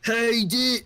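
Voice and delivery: high put-on king voice, abandoned; king voice attempt. GM testing a king's voice, high. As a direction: test the voice up high then stop. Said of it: "get a voice ready".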